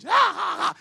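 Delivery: loud, high mock laughter. Preacher imitates youths mocking his accent, loud mock laugh. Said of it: "Il se met à dire".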